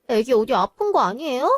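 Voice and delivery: ordinary talk, nothing marked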